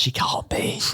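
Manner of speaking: whispered, half to himself; whispered denial. Real, not acted. Fan refusing to believe the worst of a singer, whispered. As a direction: breathe it out as if it's a guilty secret.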